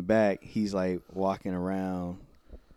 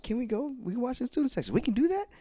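much higher, eager pleading voice; eager student; much faster than usual. Retold or imitated. Students begging the coach to go watch, high and eager. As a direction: beg like an excited kid. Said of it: "He's like".

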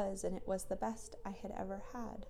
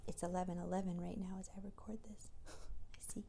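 whisper; 9 dB quieter, faster than usual. Real, not acted. Late-night whispered recording.

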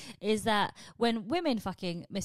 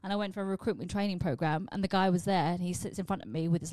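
ordinary talk, nothing marked

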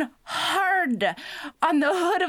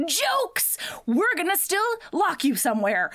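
high-pitched gleeful gotcha, first word popped; spring the cruel joke, pitched up; mock taunt; 6 semitones higher than usual. Bullies tell Pearl she's getting locked up, mock taunting.

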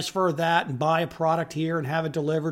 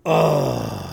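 disgusted groan; 8 semitones lower, 4 dB louder than usual. Real, not acted. Disgusted after the rant.

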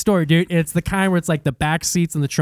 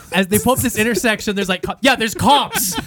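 ordinary talk, nothing marked

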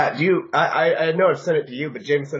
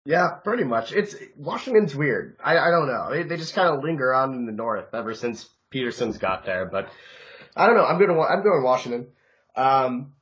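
usual speaking voice

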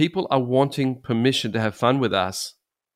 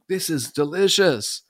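eager delight; 7 semitones higher than usual. Retold or imitated. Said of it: "And I was going".

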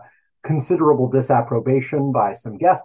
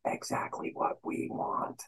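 whispered aside; 4 semitones lower, 11 dB quieter than usual. Acted. Sarcastic aside about the media.

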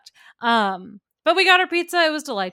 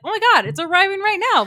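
higher, fast, louder alarmed blurt; mock panic; 8 semitones higher, 5 dB louder, faster than usual. Telling her cohost how she freaked out over the delivery, mock panic. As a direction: rattle it off in a fake panic.

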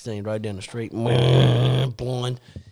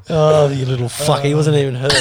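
ordinary talk

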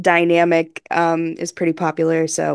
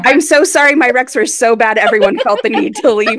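mock hurt apology; 6 semitones higher, 8 dB louder than usual. Mock apology as friends leave.

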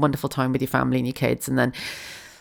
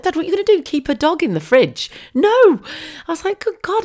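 much higher, louder incredulous voice; exasperated disbelief; 4 dB louder than usual. Retold or imitated. Daughter to her dad, high exasperated disbelief. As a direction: squeak it out in disbelief.

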